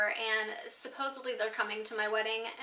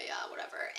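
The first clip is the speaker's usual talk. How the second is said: dismissive whisper; 5 dB quieter than usual. Retold or imitated. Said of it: "He was like".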